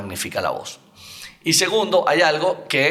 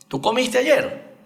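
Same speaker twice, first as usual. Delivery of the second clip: ayer pitched much higher, slow question lift; rising question tune; 11 semitones higher, slower than usual. Shown as a demonstration. Coach demos question tune for student, rising. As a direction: ask it slowly with the end climbing. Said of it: "Termina arriba".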